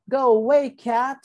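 loud, high order to get out; shouted. A narrator's reading. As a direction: shout it high to drive the cat off. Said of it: "shouted Keeper".

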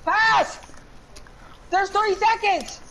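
high, frantic call to a teammate; urgent shouting. Real, not acted. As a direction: yell for the ball as time runs out. Urgent calling for a pass.

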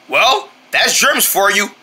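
normal talking voice

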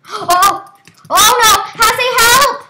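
sudden high, loud panicked scream for help. Real, not acted. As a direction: shriek for help as the wolf dies. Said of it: "I love how you scream".

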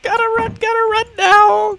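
very high frantic squeal; panicked shriek. Real, not acted. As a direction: squeal it while fleeing. Fleeing monster.

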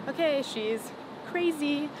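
slow, skeptical aside; playful slow mock doubt; mock listener reaction. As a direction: drawl it like they think she's crazy. Imagined listener's reaction to story.

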